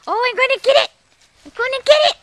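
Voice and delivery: high, teasing threat to the dog; play-taunt the dog; playful teasing threat. Acted. Playfully threatening dog over stick.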